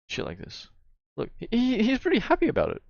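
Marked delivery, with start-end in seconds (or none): 1.2-2.8 mock delight. Real, not acted. Sarcastic praise of friend's reaction.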